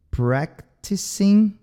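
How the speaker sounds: "practicing" stretched out slowly; slow model practicing. Shown as a demonstration. Teacher slowly models "practicing" for Brazilian students. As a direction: draw "practicing" out, syllable by syllable. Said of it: "causa um pouco de problema".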